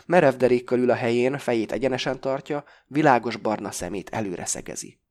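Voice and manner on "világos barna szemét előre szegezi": usual speaking voice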